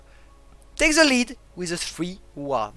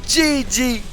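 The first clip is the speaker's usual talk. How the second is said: much higher and louder, full hype; excited shouting; 11 semitones higher, 9 dB louder than usual. Real, not acted. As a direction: yell it like the crowd is on its feet. Big fight breaks out.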